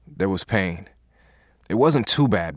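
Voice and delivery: usual speaking voice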